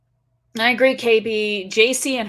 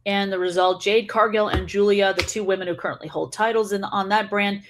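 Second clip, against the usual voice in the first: ordinary talk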